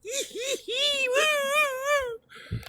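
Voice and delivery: high squealing giddy laugh, mocking. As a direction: shriek like a giddy officer mocking. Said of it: "She was so giddy".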